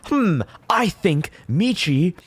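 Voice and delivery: high, slow, smug provocation; mock drama bait. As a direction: play the troll stirring up drama on purpose. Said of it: "Like, say".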